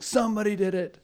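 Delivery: huffy accusation. Retold or imitated. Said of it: "It's like".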